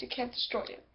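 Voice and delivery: ordinary talk, nothing marked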